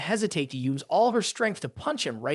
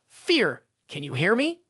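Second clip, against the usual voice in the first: plain reading voice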